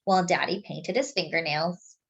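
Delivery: ordinary delivery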